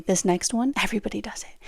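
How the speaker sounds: hushed whisper, leaning in close; conspiratorial whisper to the listener. Acted. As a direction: whisper it like a secret. Teasing a secret tip.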